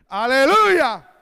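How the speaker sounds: loud, drawn-out hallelujah; praise shout. Real, not acted. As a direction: call it out to the whole congregation. Calling out praise to congregation.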